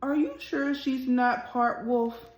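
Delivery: gentle, expressive storybook reading; reading to children. A narrator's reading. Teacher reading a picture book aloud to her class, expressive storytelling. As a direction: read it like story time for little kids.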